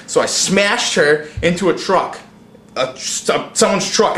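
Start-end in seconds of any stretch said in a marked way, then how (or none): none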